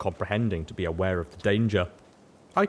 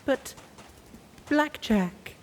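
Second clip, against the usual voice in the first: whimpering; slower than usual. A narrator's reading. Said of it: "she whimpered".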